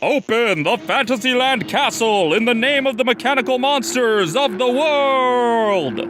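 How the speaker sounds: high, slow villain declaration; villain proclamation. Acted. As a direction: boom it out like a mad villain.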